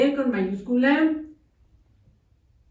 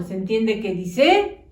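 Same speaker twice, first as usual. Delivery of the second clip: ordinary talk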